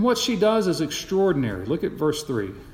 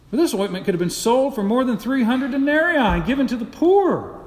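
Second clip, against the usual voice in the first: higher, louder, furious scolding; indignant outrage; 7 semitones higher, 4 dB louder than usual. Acted. Onlookers berate the woman over the wasted ointment, furious. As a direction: nostrils flaring, lay into her. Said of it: "said to themselves indignantly".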